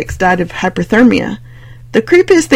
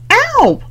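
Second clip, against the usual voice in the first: high yelp of pain; 4 dB louder than usual. Real, not acted. Swat hits Gwendy.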